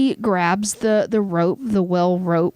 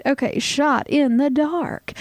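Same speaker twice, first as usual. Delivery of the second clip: segment announcer; 6 semitones higher than usual. Acted.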